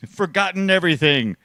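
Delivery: high, loud theatrical scolding; mock outrage. Host playfully scolds a forgetful co-host, high mock outrage. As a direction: berate them in hammed-up indignation.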